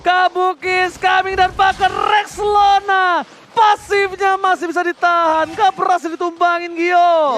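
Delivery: excited shout. Real, not acted. Caster hype call on play.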